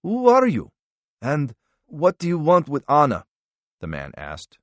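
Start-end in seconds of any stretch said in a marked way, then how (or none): 0.0-3.3 high, louder demand to an intruder; cornered man demanding answers; alarmed challenge. A narrator's reading. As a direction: challenge him with sharply raised pitch.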